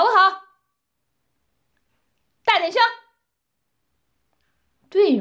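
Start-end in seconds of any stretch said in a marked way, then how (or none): 2.4-2.8 loud commanding demand; commanding mistress demands a louder answer; barked order. Acted. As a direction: snap the order at him.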